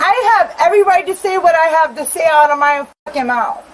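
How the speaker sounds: loud, defiant shouting; angry yelling. Real, not acted. Woman shouting at officers on her doorstep, angry. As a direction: shout your right to speak. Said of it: "you're being too loud".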